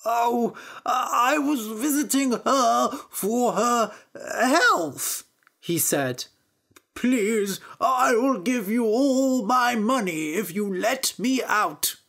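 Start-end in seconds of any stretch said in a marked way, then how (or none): 0.1-5.2 high, slow, whimpering excuse; flustered priest. A narrator's reading. Priest explains being in the wife's basket, flustered whimper. 6.9-12.0 high-pitched, whiny storybook plea; begging priest. A narrator's reading. Priest offers Little Peter money for release, begging.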